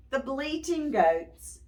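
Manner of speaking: ordinary delivery, nothing marked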